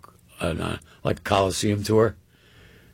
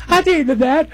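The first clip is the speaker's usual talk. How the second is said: high, loud raspy Cotton impression; imitating Cotton; 8 dB louder than usual. Storyteller mimicking Cotton, amazed at the dinner invite, high and loud. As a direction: voice Cotton's delighted disbelief, much higher. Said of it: "He goes".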